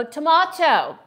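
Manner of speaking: tomato as British tomahto, with a long open A; tomato said tom-AH-to for a British friend. Shown as a demonstration. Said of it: "now I'm saying tomato".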